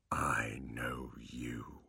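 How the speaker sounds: slow, breathy whisper. Acted. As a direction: draw it out in a whisper. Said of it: "he said slowly".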